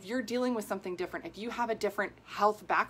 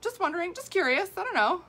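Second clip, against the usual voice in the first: much higher, casual and clueless; innocent high voice; 11 semitones higher, 5 dB louder than usual. Retold or imitated. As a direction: shrug it off in a high little voice. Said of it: "I was like".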